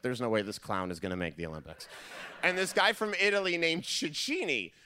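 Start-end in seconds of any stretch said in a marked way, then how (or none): 0.0-1.9 scoffing doubt. Retold or imitated. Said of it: "I was like".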